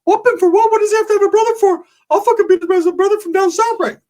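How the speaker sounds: very high squeaky outburst; excitable Ricky impression. Ricky offering to be the brother himself, very high squeal. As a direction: object in a cartoonish squeal. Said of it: "Ricky's like".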